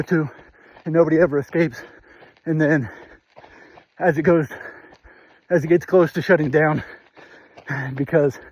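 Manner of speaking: out of breath mid-run; talking while jogging, breathing hard; while running. Real, not acted. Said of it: "terrible breathing".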